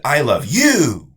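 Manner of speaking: slow, loud, rising on you; stress on YOU. Shown as a demonstration. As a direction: lean on you slowly and loudly. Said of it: "you are the person I love".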